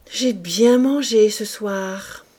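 ordinary delivery, nothing marked

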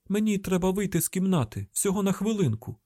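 insistent high-pitched reassurance; urgent explaining. A narrator's reading. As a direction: call down that you must step away. Urgent explanation to hostage below.

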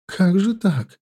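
high quavering repeat; trembling voice. Acted. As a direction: shaken and close to tears. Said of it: "повторяла дрожащим голосом".

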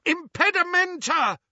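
high, ringing incantation; high-pitched battle cry; yelled spell. A narrator's reading. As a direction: yell the spell at oncoming attackers. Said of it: "he pointed at the middle of the oncoming figures and yelled".

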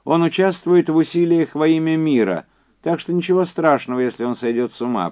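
high, loud, emphatic caller; Quincy's phone voice. Acted. Quincy telling Andy about the test survivors, high and insistent. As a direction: push it up high and project.

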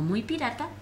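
ordinary delivery

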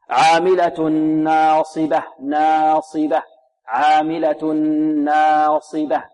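slow recitation, ناصبة with a plainly open, light alif; correct medium fatha. Shown as a demonstration. Teacher models the right reading, correct medium fatha. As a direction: open the aa in ناصبة evenly, drawn out slowly. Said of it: "هكذا".

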